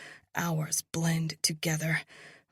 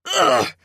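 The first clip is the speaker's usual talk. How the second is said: sharp grunt of pain; a jolted pained grunt; 2 semitones lower, 12 dB louder than usual. Acted. Prod jabbed into his thigh.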